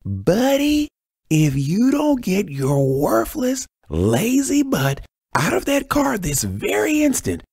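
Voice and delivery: very high, slow, strained holler; drawn-out high-pitched angry yell, held back in volume; furious far-carrying yell. A narrator's reading. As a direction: holler a threat across open fields. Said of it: "Joyce yelled so loud her voice carried".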